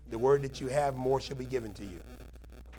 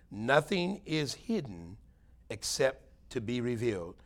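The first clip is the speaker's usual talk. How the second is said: slow, weighty repeat; slower than usual. Shown as a demonstration. Preacher repeats the verse so the congregation thinks, slow and weighty. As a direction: stretch out "Nothing is hidden" very slowly. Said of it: "I want you to think about that today".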